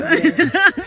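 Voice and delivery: high-pitched laugh. Real, not acted. Host amused as she wraps up, high laughing. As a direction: break into a light, high laugh. Laughs after closing joke.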